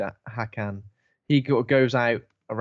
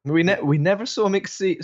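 mock imitation of co-host; 7 semitones higher, 4 dB louder than usual. Mocking co-host's stumbled line.

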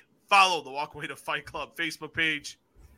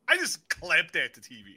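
ordinary delivery

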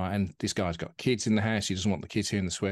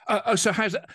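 higher, louder, flustered acted opener; mock-awkward small talk, pitched up; awkward silence-filler; 10 semitones higher, 5 dB louder than usual. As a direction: blurt it out to fill an awkward silence. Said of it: "we'd all be very awkward".

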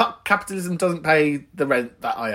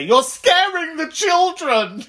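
high, drawn-out mock cry; mock scolding; 14 semitones higher, 4 dB louder, slower than usual. Imagined complainer going round there to protest, mock scolding. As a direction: cry it out slowly in a high put-on voice. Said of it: "to go round there and say".

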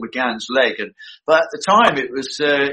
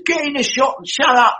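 higher mock-stern telling-off; retold angry order; 9 semitones higher than usual. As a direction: imitate the director losing patience. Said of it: "And he said".

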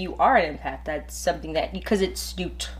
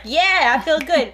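higher, upbeat mock cheer; amped up; 7 semitones higher, 3 dB louder than usual. Friend retelling how energized she felt on the call. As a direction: sound newly energized and happy. Retelling own pumped-up mood.

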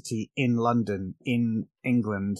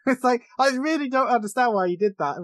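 laughing while talking; 12 semitones higher than usual. Real, not acted. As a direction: crack up while protesting in bafflement. Laughing over an expected punchline.

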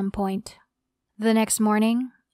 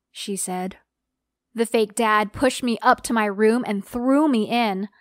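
ordinary delivery

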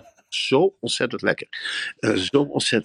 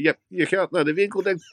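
normal talking voice

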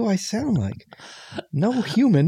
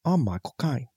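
Michael Caine impression; 4 semitones lower, 7 dB quieter than usual. Shown as a demonstration. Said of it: "watched so many Michael Caine movies".

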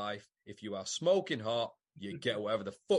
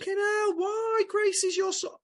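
very high, loud squealing outrage; mock outraged shriek; 8 dB louder than usual. Teasing Tim about his girlfriend, high mock-outraged shriek. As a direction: shriek it in fake disbelief.

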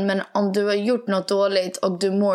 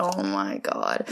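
low, quiet, slow groan of disbelief; much lower, slow, fed-up mutter; exasperated sigh; 12 semitones lower, 5 dB quieter, much slower than usual. Retold or imitated. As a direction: deflate as if you can't believe it. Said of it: "jag blir så här".

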